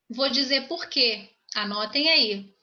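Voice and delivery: ordinary talk, nothing marked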